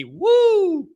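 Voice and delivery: a high whooping cheer; hyped radio host whoop; excited woo. Real, not acted. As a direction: let out a big celebratory woo.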